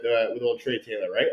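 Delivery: normal talking voice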